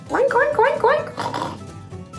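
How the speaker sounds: squeaky high oinking; high pig oinks. Pretend. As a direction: oink like a little piggy. Pig sounds for kids.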